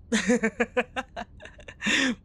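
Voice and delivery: a bright, playful laugh after teasing; amused giggle at the friend. Acted. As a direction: laugh at your own little secret.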